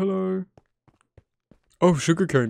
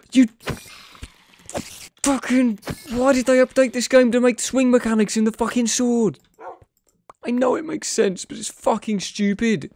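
frustrated rant; 11 semitones higher than usual. Real, not acted. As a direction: vent at the game in a raised yell. Ranting about game sword mechanics.

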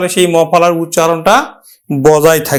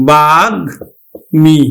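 বাগ্মী stretched very slowly, গ and ম apart; careful slow model of বাগ্মী; slow teaching demo; 4 semitones lower, much slower than usual. Shown as a demonstration. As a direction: spell out বাগ্মী slowly with your voice. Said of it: "আমরা উচ্চারণ করবো কিন্তু".

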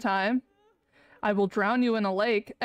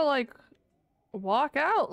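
ordinary talk

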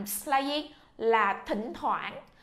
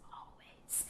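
"Always" whispered with no voice; 25 dB quieter than usual. Shown as a demonstration. Teacher whispers "Always" for the class to echo.

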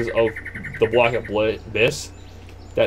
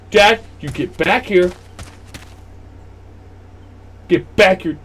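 raised, higher mock-scolding call; calling after dad; 7 semitones higher, 5 dB louder than usual. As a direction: order an absent father back, loudly. Calls after the departed father.

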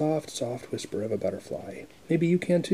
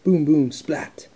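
slow loud booms; booming thunder; 3 semitones higher, 8 dB louder, slower than usual. Acted. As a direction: boom it out slow and big. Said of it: "let's review".

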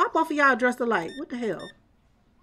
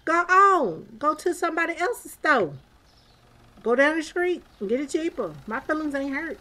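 higher, sassy dismissal of a cheap customer; sassy brush-off; 7 semitones higher than usual. Retold or imitated. Store owner dismissing a customer who complains about prices, sassy and high. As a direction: shoo them off to another store. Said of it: "I'm like".

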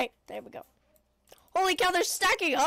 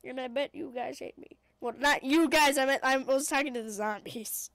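ordinary talk, nothing marked

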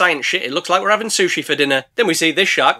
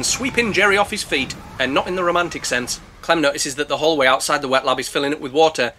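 ordinary talk

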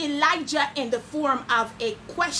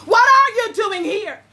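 God asking; 6 semitones higher, 6 dB louder than usual. Acted. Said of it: "saith God".

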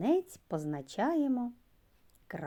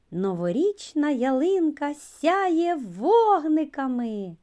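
higher, louder and slow, full of delight; exclamatory sentence; 8 semitones higher, 6 dB louder, slower than usual. Shown as a demonstration. Teacher showing children an exclamation. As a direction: exclaim it with rising excitement. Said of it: "вимовляються з підсиленою, напруженою інтонацією".

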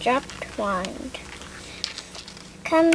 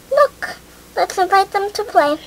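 eager urging; 7 semitones higher than usual. A narrator's reading. Chloe spots girls eagerly.

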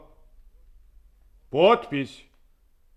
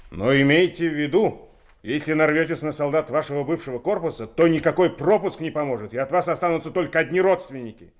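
fast, louder threat; menacing warning; 3 semitones higher, 5 dB louder, faster than usual. Acted. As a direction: warn them off sharply. Threat to released officers.

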